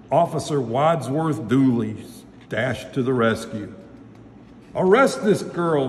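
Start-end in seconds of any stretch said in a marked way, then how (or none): 4.7-5.9 mild, higher storybook shout; raised-voice demand for an arrest; shouted accusation. A narrator's reading. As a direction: accuse like an outraged shopkeeper, only half shouting. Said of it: "Mister Cluckhorn shouted".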